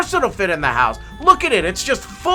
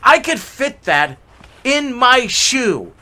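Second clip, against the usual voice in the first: sarcastic father; 8 semitones higher, 6 dB louder, slower than usual. Acted. Father mocks small tree.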